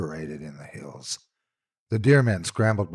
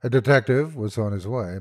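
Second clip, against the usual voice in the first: plain reading voice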